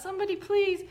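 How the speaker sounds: high, pleading damsel cry; damsel in distress. Retold or imitated. Hiker playing damsel in distress, high mock plea. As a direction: beg like a helpless damsel. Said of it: "helpless female, damsel in distress".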